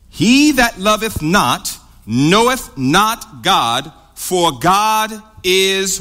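very slow, drawn-out verse reading; slow scripture reading. Real, not acted. Preacher reads a verse to the class, slow and deliberate. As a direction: read the verse word by word, stretching each.

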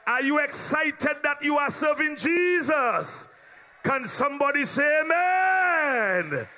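rousing preacher's greeting, shouted to the congregation; preaching. Acted. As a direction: church leader shouting greetings to the brothers and sisters. Opens the service shouting.

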